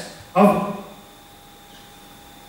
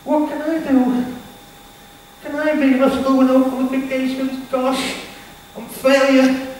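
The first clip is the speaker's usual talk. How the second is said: lightly voiced despairing younger self, higher; a hint of his old hopeless self; former self; 6 semitones higher than usual. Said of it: "For me, well".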